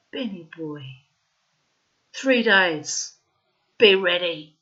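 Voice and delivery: very loud, firm acted order; commanding. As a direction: deliver the ultimatum in two hard bursts. Mother's final order, acted.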